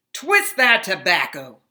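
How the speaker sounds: loud harsh order; boss yelling. Acted. Factory boss yelling at stunned Henry. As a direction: bark the order at him.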